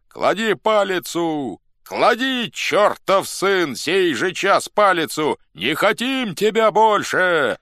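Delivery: high, long-drawn yelling; angry crowd shouts. A narrator's reading. Cossacks in the crowd depose their chief, yelling. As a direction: yell it slowly across the square. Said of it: "кричали из толпы казаки".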